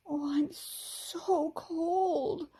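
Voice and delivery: high, slow, shivering words; shivering gasp. A narrator's reading. Freed fairy shivering with cold after the ice. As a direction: gasp it out, frozen through. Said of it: "the fairy gasped, shivering all over".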